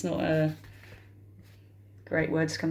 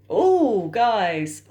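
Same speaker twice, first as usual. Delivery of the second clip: a loud high gasp of dismay at the card; shocked groan; 3 semitones higher, 6 dB louder than usual. Real, not acted. Reader reacting to a bad card, loud and shocked. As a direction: react as if the card just hit you.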